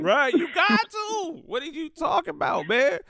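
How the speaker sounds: high, stretched emphatic agreement; excited agreement. Real, not acted. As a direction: really lean into the agreement.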